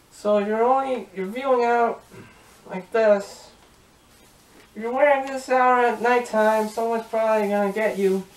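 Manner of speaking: speaking through helmet faceplate; muffled inside closed helmet. Real, not acted. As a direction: voice enclosed by mask. Seen 2: Helmet faceplate stays closed over the face through all labelled frames.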